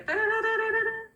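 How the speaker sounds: high, slow sung timer alarm; timer jingle. Retold or imitated. Imitating the screen timer ringing for the whole house. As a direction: sing out a playful alarm.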